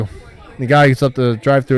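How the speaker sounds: ordinary talk, nothing marked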